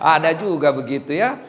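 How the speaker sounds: ordinary talk, nothing marked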